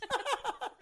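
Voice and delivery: short burst of high-pitched laughter; real squeaky laugh. Real, not acted. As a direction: break into a soft, high giggle. Laughs after retelling neighbour's reply.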